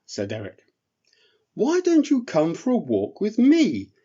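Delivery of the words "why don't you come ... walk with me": high, eager little elf voice; a small relieved voice rushing to please. Acted. Eric offers the bear soup, high and relieved.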